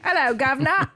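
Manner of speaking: high, hammed-up cockney greeting; fake English accent. Wife greeting the king in a fake English accent. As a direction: chirp it like a tourist's Brit. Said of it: "fake English accent".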